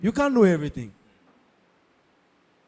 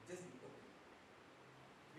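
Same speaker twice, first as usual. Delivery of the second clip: whisper; 31 dB quieter, much slower than usual. Shown as a demonstration. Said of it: "gentle advice, dropped to a hush".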